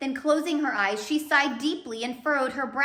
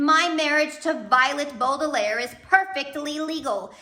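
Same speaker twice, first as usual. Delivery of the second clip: loud, high villain's proclamation; Count Olaf gloating; 5 semitones higher, 6 dB louder than usual. Acted. Villain announcing his win to the audience, loud and gloating. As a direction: crow it to the whole audience.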